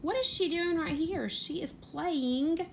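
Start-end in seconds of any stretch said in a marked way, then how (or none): none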